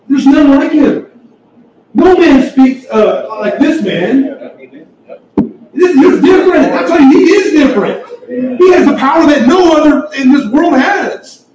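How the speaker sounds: high, loud, fervent preaching; preaching at full force. Real, not acted. Preacher exalting the Savior to the congregation, fervent and loud. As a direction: lift them up with awe in your voice.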